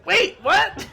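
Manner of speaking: very high, loud startled squawk; goblin yelp. Acted. Goblin hearing his sentence, high startled yelp. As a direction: yelp it in alarm. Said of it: "And he goes".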